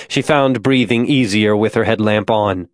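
plain reading voice

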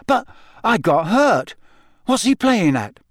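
high, baffled protest; disbelief. A narrator's reading. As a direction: question it in a high incredulous voice. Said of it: "said Harry in disbelief".

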